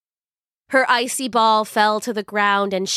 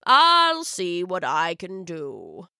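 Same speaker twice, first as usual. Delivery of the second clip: put-on amused old wizard; 3 semitones lower, slower than usual.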